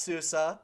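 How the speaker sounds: two syllables in "susa", ending on an open uh; "susa" rhyming roughly with Sousa; correct SUSE pronunciation. Shown as a demonstration. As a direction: say "susa" as SOO-suh. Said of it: "it's pronounced susa".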